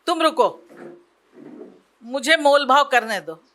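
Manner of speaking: wife cutting in to bargain; firm insistence. Acted. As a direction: take charge of the bargaining. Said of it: "वैसे ही कहा कि".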